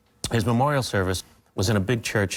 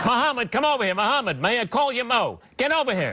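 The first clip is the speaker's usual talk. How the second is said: higher, pompous sportscaster drone; Cosell-style ringside announcer, higher and grand; Howard Cosell; 13 semitones higher than usual. Acted. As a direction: spell out every word like a self-important announcer. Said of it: "the Howard Cosell part".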